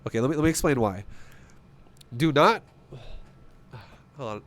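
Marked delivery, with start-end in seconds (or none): 2.1-4.4 talking around hair in mouth. Real, not acted. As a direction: hair caught in mouth while speaking. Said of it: "I got hair in my mouth".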